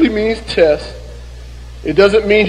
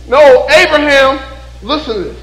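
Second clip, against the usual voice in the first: ordinary delivery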